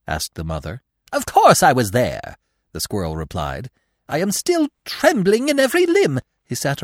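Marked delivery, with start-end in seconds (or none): 1.1-2.4 indignant squirrel. A narrator's reading. Agitated squirrel answers mother. 4.1-6.2 very high, loud squirrel voice; agitated squirrel. A narrator's reading. Squirrel telling the mother of his fright, agitated high voice.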